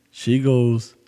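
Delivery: usual speaking voice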